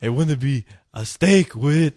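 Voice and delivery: slow vampire voice. Pretend. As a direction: purr it like a scheming count. Vampire voice continues.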